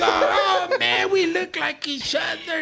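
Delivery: amused imitation of the other man's slang; mock Chinese slang. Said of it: "that Chinese slang".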